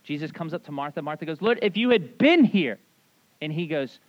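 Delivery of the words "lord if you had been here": mock reproach, BEEN pushed high and loud; reproachful stress on BEEN. Martha confronts Jesus over her dead brother, reproachful, punching "been". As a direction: hit "been" hard, higher and louder.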